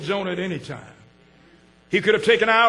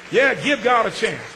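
ordinary talk

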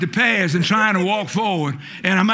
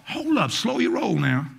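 ordinary talk

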